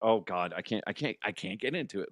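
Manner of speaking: ordinary talk, nothing marked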